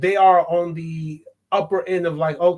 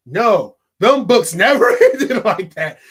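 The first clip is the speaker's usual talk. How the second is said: ordinary delivery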